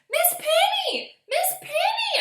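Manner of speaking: high, loud panicked calling; crying out. A narrator's reading. Girl calling her teacher about missing caterpillars, panicked high shout. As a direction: call for the teacher in alarm. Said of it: "I cried".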